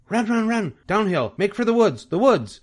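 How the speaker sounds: high, urgent holler; hollering goose. A narrator's reading. Goose hollering to fleeing pig, high and urgent. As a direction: cry out directions up high. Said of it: "started hollering".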